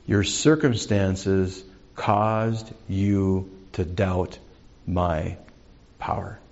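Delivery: slow, grave emphasis. Acted. Jesus telling Peter why he doubted, slow and deliberate. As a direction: spell out the rebuke slowly, sinking in volume.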